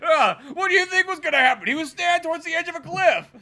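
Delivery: high-pitched mock indignant yelling; defensive protest. Acting the accused partner protesting.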